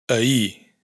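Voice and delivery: "Ahir" catalán, dicho "aí"; sin erre final. Shown as a demonstration. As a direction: di "Ahir" acabando en la i. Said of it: "esta omisión de la R afecta especialmente". (erre final muda en "Ahir")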